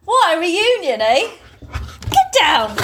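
high, sing-song voice for a puppy. Real, not acted. Asking the dog to greet its daughter, high cooing voice.

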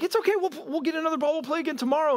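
put-on high-pitched, easygoing boy's reassurance; reassuring kid. Benny tells Smalls they'll get another ball, high and reassuring. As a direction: voice an unworried friend, pitched well up.

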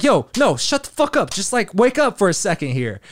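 mushroom voice talking back. Pretend. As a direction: snap him awake as the mushrooms would. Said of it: "it's like".

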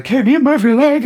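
high, loud mock-tearful question; imitated crying girl. Crying girl looks up at the man on stage, imitated tearful squeal. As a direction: squeal it out as if crying, for comic effect. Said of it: "she's crying".

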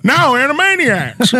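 high, slow announcing as a comic president; mock station announcer in a politician's voice; Joe Biden. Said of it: "It's your favorite president".

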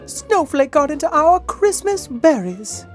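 high-pitched wifely complaint; calling back indoors. A narrator's reading. Wife calls to George about the cat, complaining. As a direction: call it into the house, high and upset. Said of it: "she called back into the house".

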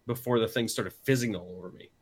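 ordinary delivery

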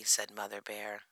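ordinary delivery

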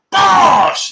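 big high-pitched, loud shout; celebratory shout. Real, not acted. Thanking a viewer for a super chat, celebratory shout. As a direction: burst out with a sudden, loud exclamation.

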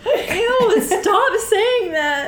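high-pitched, giggling ew; laughing protest. Real, not acted. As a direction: squeal it through laughter. Friend grossed out, laughing.

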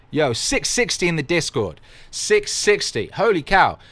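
loud, high-pitched exclaiming; real hyped astonishment; excited amazement. Real, not acted. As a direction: burst out thrilled at the big number. Amazed at the Discord member count.

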